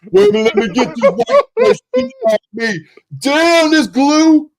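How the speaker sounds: hammed-up Ving Rhames impression. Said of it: "turned into Ving Rhames".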